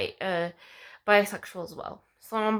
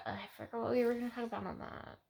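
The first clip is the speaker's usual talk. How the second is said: whisper; 11 dB quieter, much faster than usual. Real, not acted. Trailing whispered aside.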